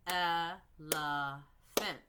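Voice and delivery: Elephant drawn out very slowly, sound by sound; stretched out slowly. Shown as a demonstration. Teacher showing the class by stretching the word slowly. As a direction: stretch Elephant out so the onset and rhyme can be heard. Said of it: "I'm looking for the beginning sound and the end sound".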